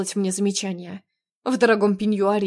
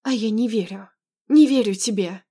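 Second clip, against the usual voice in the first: usual speaking voice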